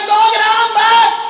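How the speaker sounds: slow, loud and high, stressing बस; emphatic insistence. Retold or imitated. Monk firmly insists on just one hundred grams, slow and loud. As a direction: drag out सौ ग्राम बस firmly.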